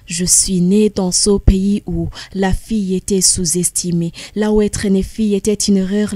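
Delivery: rhythmic spoken-word delivery; slam poetry recitation. Acted. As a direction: declaim it like a slam poet on stage. Slam recitation of her text.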